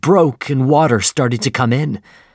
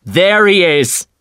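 higher, louder, slow cry; shouted; 7 semitones higher, 5 dB louder, slower than usual. A narrator's reading. Ahab sighting the whale, loud high shout. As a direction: call out the sighting. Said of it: "shouted Captain Ahab".

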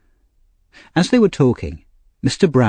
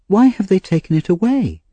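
Oliver; 8 semitones higher than usual. A narrator's reading.

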